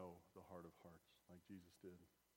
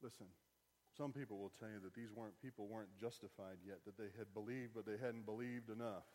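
ordinary delivery

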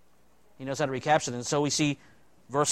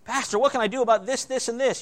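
whiny congregant; 7 semitones higher than usual. Retold or imitated. Imitating congregant asking pastor.

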